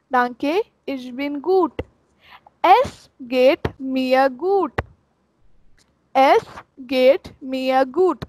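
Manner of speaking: very slow, word by word, Es geht mir gut; slow demo. Shown as a demonstration. Teacher drilling the answer phrase, very slow. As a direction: spell it out slowly for beginners. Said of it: "slow demo".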